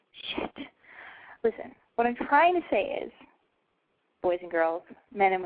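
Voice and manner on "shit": whispered curse. Real, not acted.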